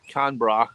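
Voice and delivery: "Canberra" as two syllables, CAN-bra; attempted Australian "Canberra", like "Can-bra"; Aussie pronunciation. Shown as a demonstration. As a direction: say "Canberra" with the middle vowel dropped. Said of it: "We're gonna get you an accent".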